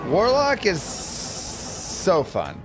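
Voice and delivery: hissing, stretched-out S sounds; drawn-out s like a snake. Real, not acted. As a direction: hold every S like a snake. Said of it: "Sorry for holding my S's there".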